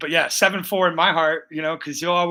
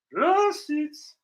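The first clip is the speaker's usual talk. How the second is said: higher, drawn-out "Receipts"; high mock call; 11 semitones higher than usual. Pretends to produce proof.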